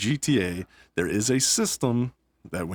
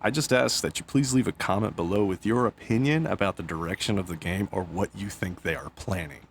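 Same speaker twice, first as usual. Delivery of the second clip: ordinary talk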